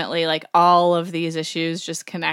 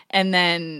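ordinary talk

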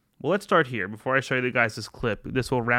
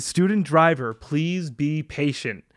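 slow, louder pleading read; mock sticker voice; 4 semitones higher, 4 dB louder, slower than usual. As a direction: plead it slowly.

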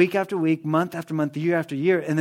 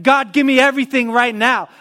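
louder and higher, an impatient demand; 6 semitones higher, 5 dB louder than usual. Acted. Believer impatiently demanding everything from God, loud and pushy. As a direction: insist like a spoiled child.

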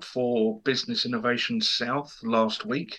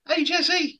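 much higher, cheerful call out; high excited greeting; 3 dB louder than usual. Acted. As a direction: hail your co-host brightly. Greeting in the intro.

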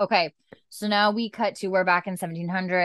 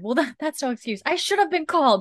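higher mock-indignant retelling of the snap; 9 semitones higher than usual. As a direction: replay the doctor's outburst, half mocking. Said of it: "so pissed".

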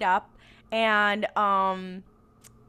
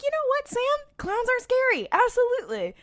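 much higher playful voice; high mock voice; 14 semitones higher than usual. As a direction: squeak it up high. Speaker voices herself to Sam.

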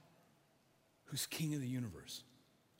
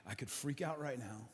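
normal talking voice

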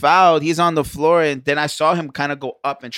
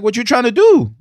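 mock confrontation; 9 semitones higher, 6 dB louder than usual. Squaring up to opponent.

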